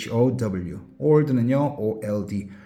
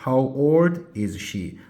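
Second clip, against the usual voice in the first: slow, clear teacher model; slow classroom demonstration; slow model phrase; much slower than usual. Shown as a demonstration. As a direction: say each word slowly for students. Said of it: "영어로 어떻게?".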